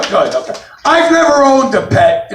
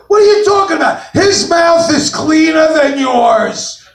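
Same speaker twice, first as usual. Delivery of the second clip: high-pitched mock outrage; indignant girlfriend; 7 semitones higher than usual. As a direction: snap back high, stretching the words out. Girlfriend's acted indignant retort.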